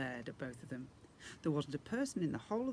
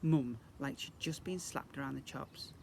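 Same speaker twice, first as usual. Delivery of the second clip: usual speaking voice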